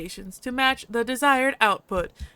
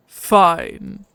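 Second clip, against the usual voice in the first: a hint of a gruff alien dog-man; slow, heavy foreign-sounding voice; gruff alien husky; 3 semitones lower, 6 dB louder than usual. The husky speaking to the stranger, lightly gruff.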